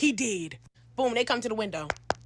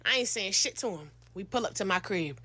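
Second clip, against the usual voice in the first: ordinary delivery, nothing marked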